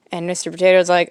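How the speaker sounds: ordinary talk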